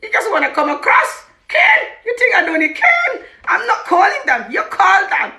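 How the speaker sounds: high, shouted accusations; hammed-up indignant yelling; imitating crazy, angry husband. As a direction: rant loudly with laughter creeping in. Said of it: "He was crazy".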